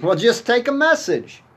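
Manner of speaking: high, loud and eager; excited. Acted. Ray tells his wife he's off to the hardware store, high and loud with excitement. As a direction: burst out with it, thrilled.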